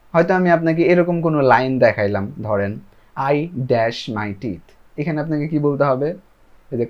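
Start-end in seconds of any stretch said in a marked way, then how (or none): 3.2-4.7 each word slow and separate; slow model sentence. Shown as a demonstration. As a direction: spell it out slowly for learners. Teacher models gap sentence.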